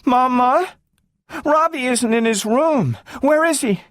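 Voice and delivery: put-on high, worried child voice; scared girl asking her mother; frightened girl. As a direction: ask in alarm.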